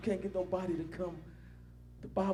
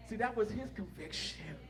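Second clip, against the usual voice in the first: ordinary talk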